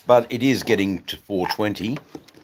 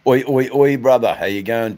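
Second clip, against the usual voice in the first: loud greeting; 5 semitones higher, 6 dB louder than usual. Real, not acted. Calling out greeting to mate.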